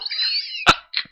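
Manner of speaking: breathless squealing laughter; high wheezing laugh. Real, not acted. Cracking up at his friend's jokes, wheezing laugh.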